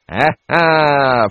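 breve risata maliziosa; risata complice. A narrator's reading. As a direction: risponde all'occhiata dell'assistente. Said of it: "restituendo l'occhiatina". (risatina complice del curato)

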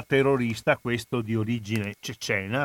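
ordinary talk, nothing marked

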